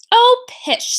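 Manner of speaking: dismissive mother. Acted. Mother waving off the bullies, scoffing. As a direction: brush it off like a doting mom.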